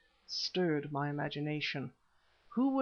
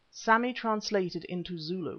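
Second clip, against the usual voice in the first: ordinary delivery, nothing marked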